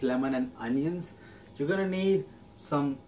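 usual speaking voice

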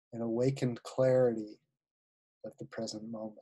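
unhurried guided-visualization voice with long pauses; guided meditation. Acted. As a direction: ease listeners into relaxation, phrase by phrase.

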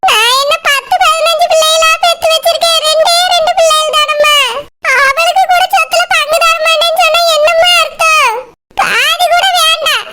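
tearful, wavering plea; crying. Acted. Mother-in-law begging even a quarter share for her daughter, crying. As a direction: beg through sobs, voice trembling. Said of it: "இப்படி எல்லாம் அழுதுட்டு நிக்காதீங்க".

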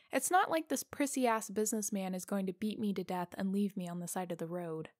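ordinary delivery

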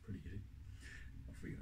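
ordinary talk

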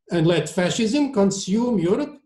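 put-on high, challenging Italian-accented question. A narrator's reading. Maestro challenges surgeon about Europe, Italian accent. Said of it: "in his heavy Italian accent".

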